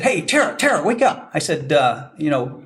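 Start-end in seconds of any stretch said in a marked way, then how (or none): 0.0-1.3 higher, calling out to wake a sleeping colleague; calling to wake her. Retold or imitated. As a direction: call her name to rouse her.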